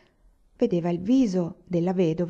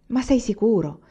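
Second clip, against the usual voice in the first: higher, disbelieving question, stretched on the repeat; astounded; 5 semitones higher, 4 dB louder than usual. A narrator's reading. Old woman doubts what Anania tells her, astounded. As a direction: ask it stunned, pressing for certainty. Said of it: "chiese la vecchia sbalordita".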